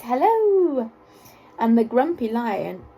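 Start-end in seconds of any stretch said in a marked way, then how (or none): 0.0-0.9 hissing snake. A narrator's reading. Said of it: "the snakes would hiss".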